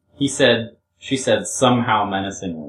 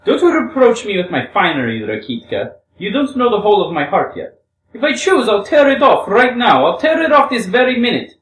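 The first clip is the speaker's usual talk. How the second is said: high, loud, defiant declaration; impassioned woman's cry, rising in force; ringing cry; 11 semitones higher, 7 dB louder than usual. Acted. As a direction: let the voice ring out high, building to the threat. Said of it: "she cried in a ringing voice".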